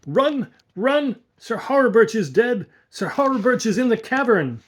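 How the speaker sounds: high, loud panicked shouting; breathless alarm to the household; frantic cry. A narrator's reading. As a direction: scream the warning as if fleeing horror. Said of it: "cried in a stifled voice".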